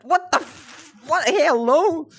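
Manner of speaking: high, loud stunned outburst; shocked disbelief. Real, not acted. Streamer stunned by what chat says, high and loud. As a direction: react like you can't believe it.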